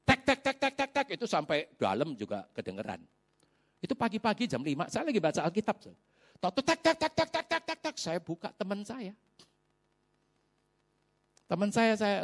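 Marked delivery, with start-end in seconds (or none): 0.0-1.1 rapid vocal rat-a-tat; imitated knocking. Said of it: "diketok-ketokin di". 6.7-7.9 high, rapid vocal rat-a-tat; imitated knocking. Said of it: "ada ketok-ketok di rumah saya".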